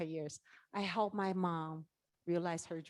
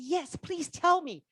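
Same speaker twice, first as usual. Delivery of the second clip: slow, higher, pleading voice; eager plea; 7 semitones higher, 6 dB louder, slower than usual. Retold or imitated. An eager student asks the mentor for the secret, pleading. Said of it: "I said".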